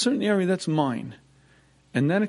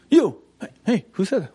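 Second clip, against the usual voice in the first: calling out; 5 semitones higher, 6 dB louder than usual. Retold or imitated. Mysterious voice calling out.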